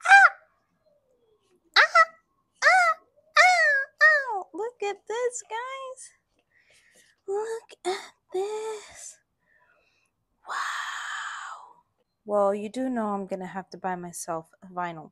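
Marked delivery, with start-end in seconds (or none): 0.1-4.5 loud high delighted shrieks over and over; fangirl screams. Real, not acted. Fan screaming at album contents. 4.5-6.1 excited. Real, not acted. Overjoyed fan showing album. 7.3-11.8 breathless, slow whisper of awe; awed whisper. Real, not acted. Awed fan whispering over album.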